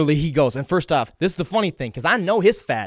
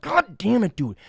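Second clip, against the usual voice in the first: high, exasperated self-reproach; frustrated; 8 semitones higher, slower than usual. Retold or imitated. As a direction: replay your frustration with yourself. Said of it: "I was frustrated by it".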